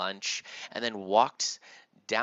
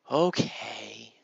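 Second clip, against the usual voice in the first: drawn-out, mildly let-down okay; disappointed sigh; 7 dB louder than usual. Acted. Timmy agrees with his father, mildly disappointed. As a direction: agree reluctantly on a long okay. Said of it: "agreed and sighed and said".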